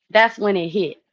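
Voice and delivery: ordinary delivery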